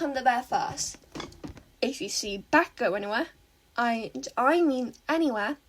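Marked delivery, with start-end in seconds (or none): none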